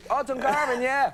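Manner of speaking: high, breezy fake recognition; airy mock familiarity; mock knowing nod. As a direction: pretend you know the town. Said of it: "Thank God I didn't go".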